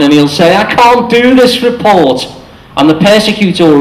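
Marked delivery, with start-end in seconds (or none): 0.6-2.2 higher, slow whine; mock helpless whine; whining victim. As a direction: whimper it like a helpless office worker. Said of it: "and he'll whine".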